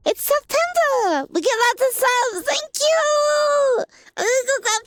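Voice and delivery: high shouted celebration; excited shouting. Real, not acted. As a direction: cheer it out loud and high. Celebrating subs.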